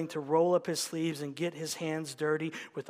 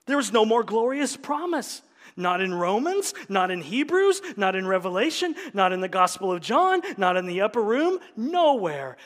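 loud, rising preacher's list; preaching at full force; 3 semitones higher, 6 dB louder than usual. Acted. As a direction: drive each not home to the climax. Preaching climax, building list.

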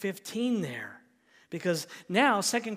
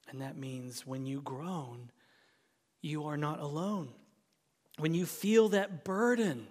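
usual speaking voice

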